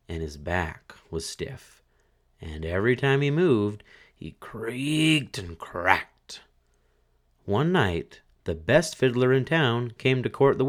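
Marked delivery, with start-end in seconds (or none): 4.1-6.4 very slow, stretched creaking words; creaky narration. A narrator's reading. Narrator showing the corpse's creaking, very slow.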